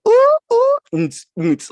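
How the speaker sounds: high squealing "ooh, ooh" then a thumping "nts, nts" beat; mock the club sound system, squeal then beatbox; club noise imitation. Mocking club music sounds.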